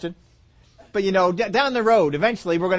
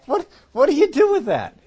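laughing question; 9 semitones higher, 5 dB louder than usual. Real, not acted. Preacher laughs at the scene.

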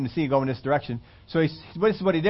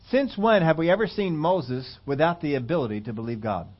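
normal talking voice

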